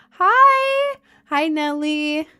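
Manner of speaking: high, loud squeal of hello; excited greeting. Real, not acted. Streamer squealing hello to a viewer, excited.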